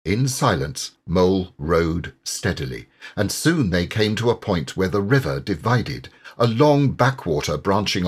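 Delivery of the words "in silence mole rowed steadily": ordinary delivery, nothing marked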